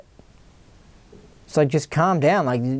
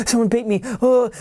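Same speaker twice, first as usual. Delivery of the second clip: higher mock startled fear; retold fright; 6 semitones higher than usual. Startled driver hearing a beep, panicky. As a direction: replay his own panic at the horn. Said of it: "genuinely scared".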